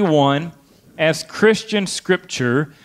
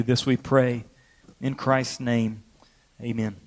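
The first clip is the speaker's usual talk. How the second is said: public prayer; 4 semitones lower, 3 dB quieter than usual. Real, not acted. Pastor praying for a grieving family, subdued public prayer. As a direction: pray quietly and earnestly for the congregation.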